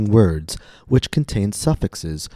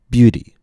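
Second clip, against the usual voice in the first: "Beauty" with a long, high, louder first vowel; stressed first syllable; 5 semitones higher, 6 dB louder than usual. Shown as a demonstration. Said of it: "long vowel, higher pitch".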